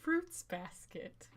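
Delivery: deadpan. Retold or imitated. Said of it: "And he'd just say".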